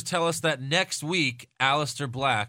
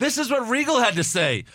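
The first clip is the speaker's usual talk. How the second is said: news reporter voice; 11 semitones higher, 5 dB louder than usual. Retold or imitated. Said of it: "she does a report on the air".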